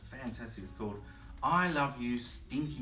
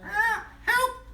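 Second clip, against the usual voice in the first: high, loud gasp for help; gasping parrot; 9 dB louder than usual. Acted. Parrot gasping for help as Big Bro nears. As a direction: gasp it in fright. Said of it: "gasped the parrot".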